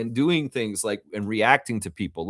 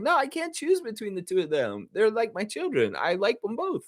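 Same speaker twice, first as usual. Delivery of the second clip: imitating her sweet high voice, much higher; cute high voice; 10 semitones higher, 3 dB quieter than usual. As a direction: coo it like a proud parent. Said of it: "She's like".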